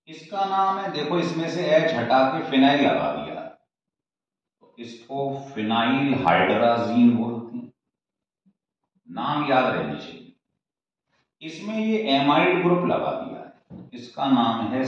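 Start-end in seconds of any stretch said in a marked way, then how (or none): none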